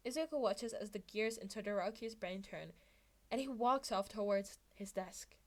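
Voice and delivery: ordinary delivery, nothing marked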